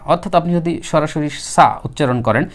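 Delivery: ordinary delivery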